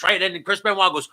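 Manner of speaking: normal talking voice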